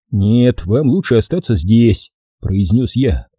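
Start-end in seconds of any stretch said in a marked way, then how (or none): none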